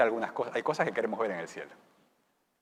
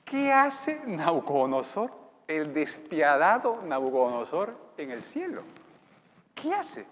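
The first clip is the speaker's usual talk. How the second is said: astonished; 3 semitones higher, 7 dB louder, slower than usual. Acted. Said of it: "habrá un montón de gente".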